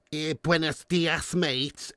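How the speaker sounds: a light mock Spanish-Scouse accent, slow; Cuban Scouser. Streamer mimicking a Cuban Scouser viewer, light Spanish-Scouse accent. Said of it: "somebody who's like".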